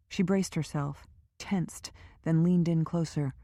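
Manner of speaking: usual speaking voice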